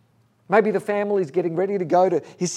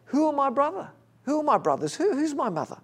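higher, playful, lightly teasing questions; almost joking; 8 semitones higher than usual. Retold or imitated. Jesus answering the crowd about his family, almost joking. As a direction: toss the question off half as a joke. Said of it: "in an almost kind of joking way".

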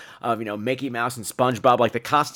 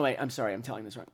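ordinary talk, nothing marked

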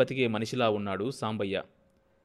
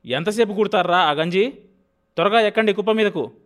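loud, fast, higher yell at lazy laborers; landlord shouting; 8 semitones higher, 8 dB louder than usual. A narrator's reading. Landlord yelling at laborers to climb the heap, loud and fast. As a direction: bellow at them to hurry up. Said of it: "కేకవేశాడు".

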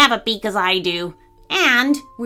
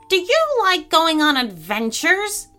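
higher, eager parrot question; 7 semitones higher than usual. Acted. Parrot asking gorilla about adventures, eager. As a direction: invite with lifted, eager pitch. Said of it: "asked him with eagerness".

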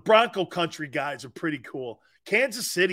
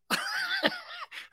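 high, softer wheezy laugh; squeaky laughter trailing on; real laughing fit; 7 dB quieter than usual. Real, not acted. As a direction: crack up at your own story. Laughs at his retold quote.

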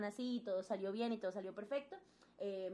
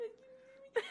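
laughing through tears; 7 dB quieter than usual. Retold or imitated. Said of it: "Y yo".

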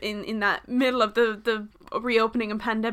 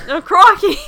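mock Australian; 7 semitones higher, 10 dB louder than usual. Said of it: "sorry to anyone that's Australian".

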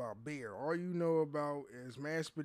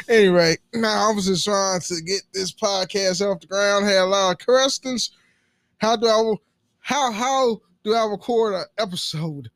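loud, hammed-up podcast ad pitch; ad read; 5 semitones higher, 17 dB louder than usual. Podcaster pitching to would-be podcasters, loud ad read. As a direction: belt out the sponsor copy high and loud.

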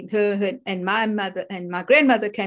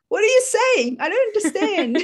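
confused listener; 12 semitones higher, 3 dB louder than usual. Retold or imitated. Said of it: "people look at me and go like".

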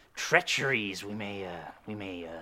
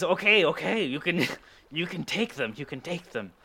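ordinary talk, nothing marked

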